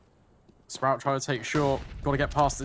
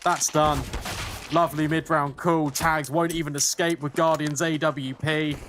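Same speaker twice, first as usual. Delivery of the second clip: excited esports caster; 4 semitones higher than usual. Real, not acted. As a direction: hype up the big play. Casting a clutch mid-round play.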